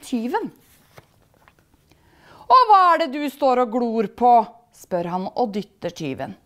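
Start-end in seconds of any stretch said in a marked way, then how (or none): 2.5-4.3 loud, raised challenge; confrontational. A narrator's reading. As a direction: pick a fight at full voice. Spirit provokes the thief.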